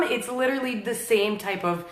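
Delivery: ordinary talk, nothing marked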